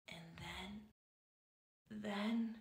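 near-whisper building suspense; hushed suspense. A narrator's reading. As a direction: hold the breath before the reveal. Suspense before giant.

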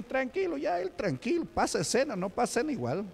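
high, soft, carefree mock voice; mocking the careless believer. Preacher mocks the carnal believer to his congregation in a high, soft, careless voice. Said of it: "el carnal ya lo dice".

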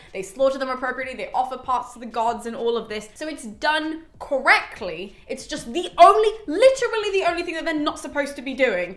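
ordinary talk

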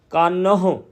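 ਕਾਨਹੁ with a distinct short "hu" at the end; clearly sounded H. Shown as a demonstration. Teacher showing correct ਕਾਨਹੁ, clear "hu" ending. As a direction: sound the final H syllable of ਕਾਨਹੁ fully. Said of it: "ਪੂਰਾ ਸਪਸ਼ਟ ਕਰਨਾ ਆ".

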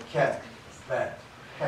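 キャ, ベ, ヘ with an English-style front vowel; English-style キャ, ベ, ヘ, one by one. Shown as a demonstration. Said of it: "英語と同じような発音ですからね".

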